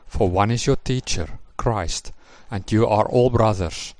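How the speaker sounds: ordinary talk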